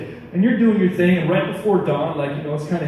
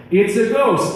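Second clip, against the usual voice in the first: a touch of alarm, higher; mild cry of fear; 7 semitones higher, slower than usual. A narrator's reading. Disciples spotting a figure on the lake, mildly frightened cry. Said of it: "cried out in fear".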